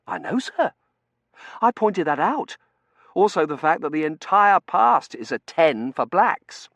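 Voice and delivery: high, loud insistence; earnest young junior. Acted. T J agreeing with Dunworthy, high earnest voice.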